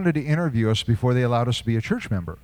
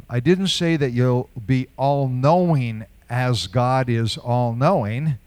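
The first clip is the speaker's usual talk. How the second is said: normal talking voice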